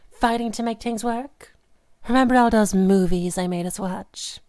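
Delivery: usual speaking voice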